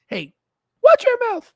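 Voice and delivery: very high, loud squeaky outburst; mock scolding voice. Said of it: "we'll be talking like this".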